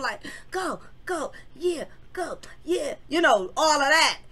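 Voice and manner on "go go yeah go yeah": high mock crowd cheers, softer; imitating cheering crowd. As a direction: cheer them on like a hyped crowd. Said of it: "cheering them on, rooting them on".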